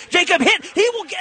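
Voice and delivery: usual speaking voice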